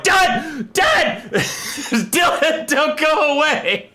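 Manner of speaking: high-pitched desperate cry for help; panicked calling. Retold or imitated. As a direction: call out in comic terror, laughing.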